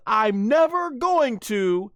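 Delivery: high, slow mock scolding; hammed-up exasperated vow; imitated ultimatum. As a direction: draw out the threat in a high voice. Acts out Bulma's ultimatum.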